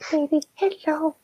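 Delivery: high, slow, soft cooing; drawn-out coo to the puppy. Real, not acted. Baby talk to dog.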